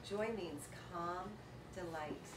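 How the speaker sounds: slow, soft and low; calm delight. Acted. Speaker defining her name Joy, slow and soft. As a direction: savour each word gently.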